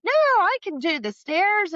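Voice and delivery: mother brushing off the help in a high, insistent voice; mom insisting. Retold or imitated. Daughter mimics her proud mother refusing help, high and insistent.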